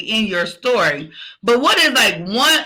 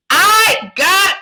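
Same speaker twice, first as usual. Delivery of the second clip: much higher, louder, very slow affirmation; strong and loud; 10 semitones higher, 4 dB louder, much slower than usual. Shown as a demonstration. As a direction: shout it so the earth shakes. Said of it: "as loud as you can".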